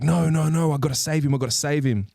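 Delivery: high, pleading cry to save him; imitating a frantic plea; frantic Rogue. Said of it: "she's just like".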